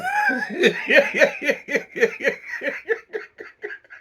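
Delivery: long, high rolling laughter. Real, not acted. Laughing long at Monica's line.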